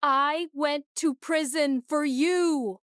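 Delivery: bitter, betrayed fury; furious outburst. A narrator's reading. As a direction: hammer each word slowly. Furious accusation at husband.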